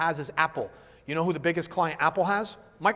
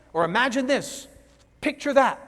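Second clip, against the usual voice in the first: high-pitched mimicked enthusiasm; visual communicator; 6 semitones higher than usual. Shown as a demonstration. Trainer mimicking visual people's picture words, high visual communicator. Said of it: "they use words that describe pictures".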